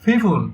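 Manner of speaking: ফিফুল opened with puffed "ph"; British-style pronunciation. Shown as a demonstration. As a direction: say people as ফিফুল, aspirating the p. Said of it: "পিপুলটা হচ্ছে ফিফুল".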